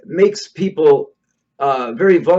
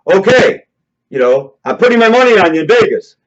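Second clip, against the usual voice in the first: fast, louder, higher mock pep talk; mock hype; 4 semitones higher, 6 dB louder than usual. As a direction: pump the volunteer up. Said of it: "he'll say".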